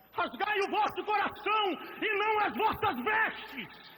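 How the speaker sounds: shouted sermon, high and loud; fiery pulpit shout; preaching at full force. Acted. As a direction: thunder it at the church.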